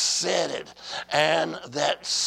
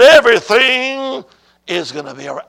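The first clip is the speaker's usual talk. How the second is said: booming divine proclamation; 5 semitones higher, 11 dB louder than usual. Retold or imitated. God answers the preacher's "What?", loud and emphatic. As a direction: proclaim it loudly, then settle down. Repeating God's answer to congregation.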